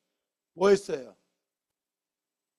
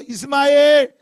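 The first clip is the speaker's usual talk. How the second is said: calling out; 8 semitones higher, 8 dB louder, slower than usual. Acted. Abraham calling Ishmael's name.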